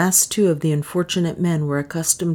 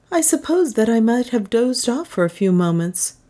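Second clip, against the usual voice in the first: higher, lightly voiced priest; apologetic priest; 6 semitones higher than usual. A narrator's reading. Francisco confesses to dozing on guard, apologetic. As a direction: admit it sheepishly, pitched up a little.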